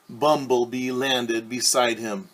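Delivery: usual speaking voice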